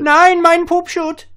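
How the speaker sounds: put-on very high, loud German-accented squeal; mock German wail. Defeated German alien protests, shrieking mock German accent. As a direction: squeal in hammed-up German, stretched out. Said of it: "but they're German".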